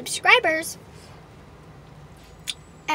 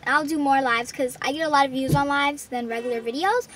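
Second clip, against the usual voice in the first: ordinary talk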